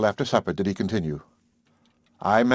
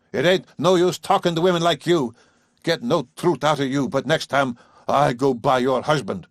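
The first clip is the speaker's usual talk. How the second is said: higher, louder parting threat; scornful farmer; 5 semitones higher, 3 dB louder than usual. Acted. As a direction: dismiss her and threaten to tell her husband. Father leaves with threat.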